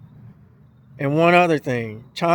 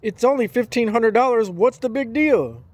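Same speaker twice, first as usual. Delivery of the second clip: mocking dismissive; 6 semitones higher than usual. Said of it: "they sit here and say".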